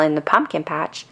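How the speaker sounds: usual speaking voice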